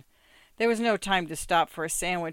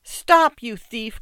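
high, very loud first word, then falling; calling out; 6 semitones higher, 10 dB louder, slower than usual. A narrator's reading. Rabbi's wife after Mendel with the coat, shouting. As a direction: shout after a fleeing thief. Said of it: "called the rabbi's wife".

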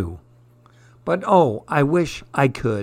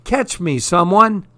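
mock panic as he slips; slipping boy yelling for someone to catch him; storybook yell; 6 semitones higher than usual. A narrator's reading.

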